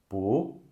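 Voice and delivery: "bu" gliding upward like a question; second tone, rising. Shown as a demonstration. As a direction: lift the pitch through "bu". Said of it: "all the four tones with bu".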